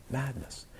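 dropped almost to a murmur; quiet echo. Real, not acted. Echoed, much quieter.

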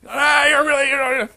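loud, high mock yelling; imitated tirade. Rinpoche really going at the student, imitated yelling. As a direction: rant at full tilt, sounds over words. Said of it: "he's really going at it".

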